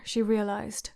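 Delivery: ordinary delivery, nothing marked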